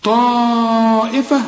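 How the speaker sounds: طائفة with the long a held four counts; obligatory madd. Shown as a demonstration. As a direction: stretch طائفة long before the hamza. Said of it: "ده مد واجب".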